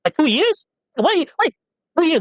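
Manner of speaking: high-pitched disbelief; can't believe the number; incredulous. Real, not acted. Disbelief at life expectancy.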